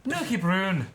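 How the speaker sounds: high mock Scottish "Nookie Broon"; hammed-up Scottish accent. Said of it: "I wish I could do a good Scottish accent".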